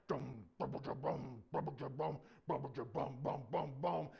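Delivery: rhythmic booming stomps; punchy mouth-made stomping; imitated giant footsteps. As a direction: thud out heavy marching steps.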